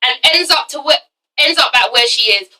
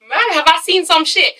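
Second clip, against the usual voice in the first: ordinary delivery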